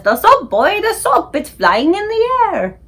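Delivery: high, rising cry of amazement; father pointing out something impossible; astonished alarm. Acted. Father alarmed at floating soap.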